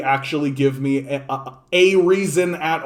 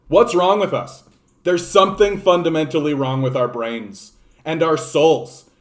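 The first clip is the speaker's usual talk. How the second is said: ordinary delivery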